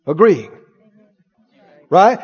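ordinary delivery

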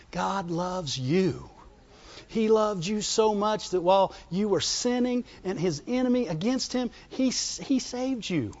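hushed and tender, slowed right down; tender reassurance. Acted. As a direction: gently tell them God loves them. Tender reassurance that God loves.

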